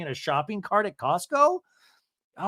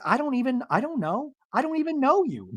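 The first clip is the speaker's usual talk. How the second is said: mock exasperation; 9 semitones higher than usual. Acted quarrel, Mary dismissing Brittany.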